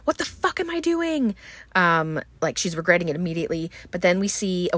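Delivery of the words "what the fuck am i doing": a retold freak-out in a much higher voice; squeaky imitated panic behind the wheel; mock screaming. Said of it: "She's, like, screaming".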